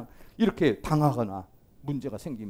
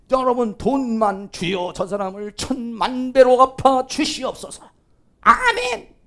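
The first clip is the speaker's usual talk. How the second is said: slow, high, loud petition ending in amen; mock fervent prayer; 6 semitones higher, 5 dB louder, slower than usual. Believer praying that the other be repaid millionfold, mock-fervent prayer. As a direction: plead hammily to the Lord for payback. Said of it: "이러잖아".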